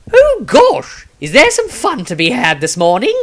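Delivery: high, bright foppish noble voice; breezy cheerful greeting to the room; friendly. Acted. Maestro greeting the worried crowd, friendly and chirpy. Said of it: "said in a friendly manner".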